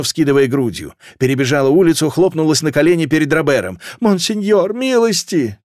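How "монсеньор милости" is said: very high, wailing entreaty; distraught high-voiced plea; desperate pleading. A narrator's reading. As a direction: beg for mercy at his feet. Woman kneels begging for mercy.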